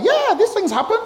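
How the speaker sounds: high, breezy shrug of a line; casual bystander. Retold or imitated. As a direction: toss it off way up high. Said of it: "somebody was like".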